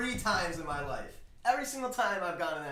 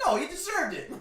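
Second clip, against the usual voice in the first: 1 defiant retort; 10 dB louder than usual. Retold or imitated. Retold defiant reply.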